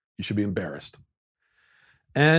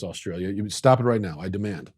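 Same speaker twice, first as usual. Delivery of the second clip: normal talking voice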